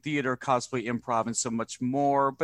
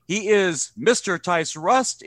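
booming announcer intro; 5 semitones higher, 5 dB louder, slower than usual. Acted.

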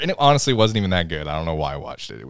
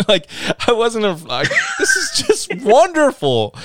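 real laughter breaking through the words, much higher; laughing; 11 semitones higher, slower than usual. Real, not acted. Gushing to co-hosts about the alligator scene, laughing hard.

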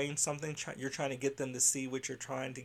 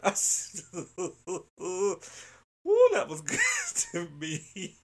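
real laughter breaking the words; laughing hard; 5 semitones higher, 5 dB louder, slower than usual. Real, not acted. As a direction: laugh through it, out of breath. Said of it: "I laughed so hard".

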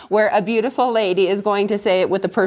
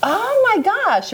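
surprised; 11 semitones higher, slower than usual. Real, not acted. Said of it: "surprised at the answer".

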